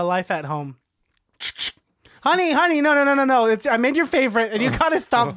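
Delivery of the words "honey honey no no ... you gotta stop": high, loud doting mom; 1950s housewife. Acted. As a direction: fuss over the kid like a sitcom mom. Doting parent at home scene.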